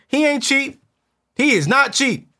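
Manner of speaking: high, loud mock outrage; playful teasing. Real, not acted. Teasing the producer about his hair, high and loud. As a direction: rib the cameraman loudly.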